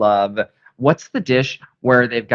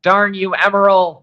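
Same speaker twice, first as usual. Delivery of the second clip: mock curse; 8 semitones higher, 4 dB louder than usual. Mock cursing ship for recipe.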